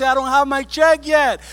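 high, loud mocking whine then shout; preacher shouting at people waiting for a check; complaining crowd. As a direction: mimic the complainers then rebuke them. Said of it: "están quejándose".

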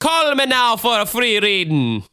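high, hammed-up Jamaican psychic pitch; bad Jamaican accent. Podcaster joking after his impression, faux Jamaican accent. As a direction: belt it in a badly faked Jamaican accent. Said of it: "that sounds less Jamaican and more Irish".

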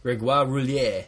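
slow French pronunciation; French accent. Pretend. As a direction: say the name the French way, slowly. Saying the French name grandly.

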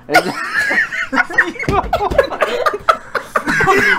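shrieking high laugh. Real, not acted. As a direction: explode into laughter. Huge laugh at camel joke.

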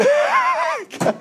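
high, loud cackle that drops away; the devil laughing off a weak command; devil's shrieking cackle. Acted. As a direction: shout of mocking laughter.

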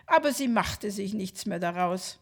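usual speaking voice

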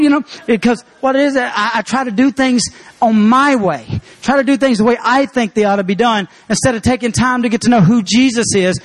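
ordinary talk

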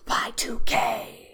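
drawn-out spooky whisper; hammed-up hushed warning; mock-ominous whisper. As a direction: whisper it slowly like a looming doom. Mock-ominous announcement of millennium.

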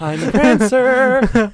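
loud, high, very drawn-out introduction; hammed-up high reindeer voice; Prancer. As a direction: sing out the name in a camp flourish.

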